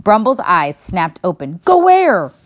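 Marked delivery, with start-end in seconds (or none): 1.6-2.3 startled bear. A narrator's reading. Bear's eyes snapped open.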